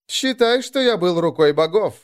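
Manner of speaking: broad smile. A narrator's reading. Moroz justifies his deed to Arngrim, smiling broadly. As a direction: answer through a broad grin. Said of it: "широко улыбнулся Мороз".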